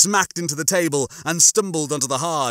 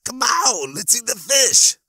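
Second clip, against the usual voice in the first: high, squeaky and louder nagging; insistent hunger pain; 10 semitones higher, 4 dB louder than usual. A narrator's reading. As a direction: squeak it insistently, pushing hard. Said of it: "but it was fairly insistent".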